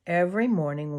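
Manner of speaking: usual speaking voice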